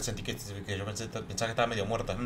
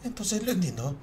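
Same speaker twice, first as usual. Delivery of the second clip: haughty schoolgirl; 11 semitones higher than usual. Acted. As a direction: play a vain writer showing off to her friend. Girl character again. (escritora engreída, voz aguda y fuerte)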